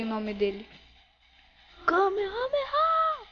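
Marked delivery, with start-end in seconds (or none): 1.9-3.3 high-pitched battle cry; attack shout. Acted. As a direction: yell the attack name high. Calling out special attack.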